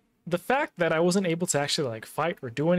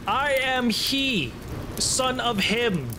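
slow, higher, drawn-out proclamation; mock-grand boast; 5 semitones higher, slower than usual. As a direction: proclaim it slowly like a mighty hero. Mock-grand boast to beaten enemies.

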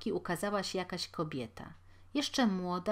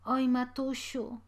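put-on higher, slow sick child's plea; sick boy whimpering; 7 semitones higher, 4 dB louder, slower than usual. A narrator's reading. Sick boy in the crate complaining to his mother, slow and pained. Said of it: "szepnął chłopak w pace".

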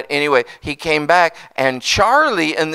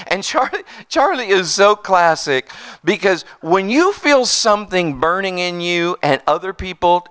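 normal talking voice

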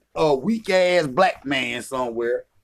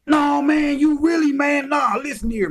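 mock shouting back; 10 semitones higher than usual. Mocking a man who denies Africa.

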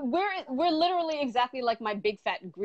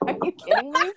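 laughing disbelief; 9 semitones higher, 3 dB louder than usual. Real, not acted. Laughing at idea of one man.

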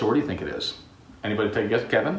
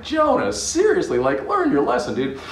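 exasperated scolding; 8 semitones higher than usual. Acted. Teacher scolding Jonah in exasperation.

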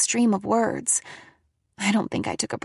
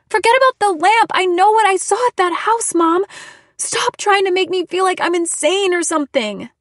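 loud, very high-pitched outburst; frantic protest; 10 semitones higher, 7 dB louder than usual. A narrator's reading. Daughter insisting to mom she is sane, frantic shouting. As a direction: shout back, desperate to be believed.

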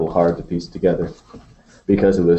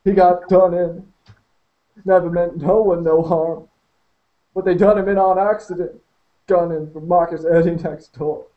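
high, sobbing lament; hysterical grief; 11 semitones higher than usual. Acted. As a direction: voice cracking with hysteria. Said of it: "Now he was really hysterical".

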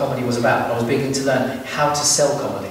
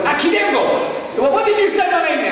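put-on dad's Armenian accent. Dad getting his son's name wrong, in a high Armenian accent. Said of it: "And he goes".

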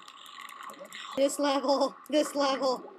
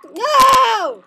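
loud, high wail of refusal; anguished shout; 4 semitones higher, 8 dB louder than usual. Real, not acted. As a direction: cry out in dismay. Suddenly caught in a net.

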